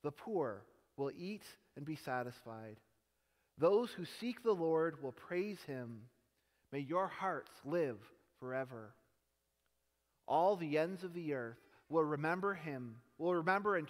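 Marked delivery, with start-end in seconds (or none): none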